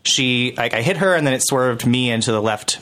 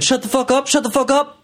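high-pitched mock shouting back; re-enacted heated retort; imitated angry yelling; 13 semitones higher than usual. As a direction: fire it back like an irate stranger. Retold yelling match before a punch.

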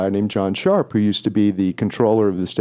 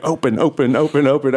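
impatient pleading; 4 semitones higher than usual. Retold or imitated. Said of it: "I was like".

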